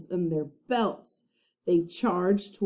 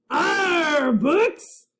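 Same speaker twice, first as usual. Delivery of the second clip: higher, louder pirate growl; disgusted pirate arr; 9 semitones higher, 6 dB louder than usual. A narrator's reading. Captain Bones disgusted at books, loud pirate growl. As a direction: spit out the word in disgust.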